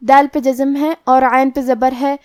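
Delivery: ordinary talk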